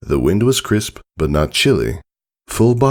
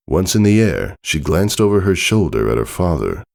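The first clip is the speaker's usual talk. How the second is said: ordinary delivery, nothing marked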